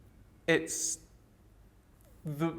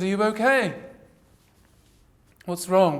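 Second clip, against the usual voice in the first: retold call from a window, a bit louder; 3 semitones higher, 4 dB louder than usual. Mum calling from the window to her crying child, mild call out. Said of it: "she called out from the window".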